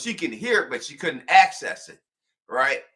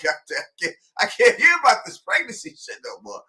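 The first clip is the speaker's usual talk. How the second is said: put-on higher, exasperated boyfriend; fed-up mock rant; 7 semitones higher, faster than usual. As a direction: rattle it off fed up. Voicing fed-up Ellis.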